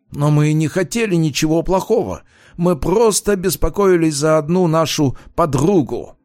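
high-pitched, put-on earnest voice; pleading student. A narrator's reading. Student excusing a night visit to police, high plea. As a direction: protest innocence in a high voice.

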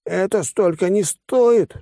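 high-pitched indignant protest; indignant outburst. A narrator's reading. Financier protesting the huge demand, flaring up indignantly. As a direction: flare up, pitch shooting up, drawn out. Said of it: "тут же взвился финансист".

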